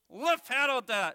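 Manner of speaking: high, loud puppet attempt; misreading puppet. Puppet misreading the verse, high loud voice. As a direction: proudly sound out a nonsense word as a puppet. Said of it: "Well, what's that first word".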